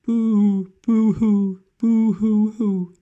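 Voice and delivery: high, loud, drawn-out boo-hoo wail; child's crying. Acted. Boy crying in bath.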